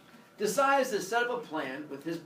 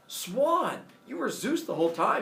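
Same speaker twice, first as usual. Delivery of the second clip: higher-pitched amazed discovery; mock astonishment; 5 semitones higher than usual. Woman seeing swan become Zeus, astonished. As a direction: act lightly stunned by the reveal. Acting her shock at the reveal.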